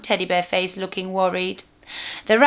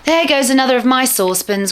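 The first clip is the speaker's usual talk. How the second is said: loud, higher despairing groan; 6 semitones higher, 8 dB louder than usual. A narrator's reading. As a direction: groan over the lost pan. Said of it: "groaned Saucepan".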